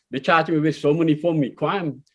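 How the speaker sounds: usual speaking voice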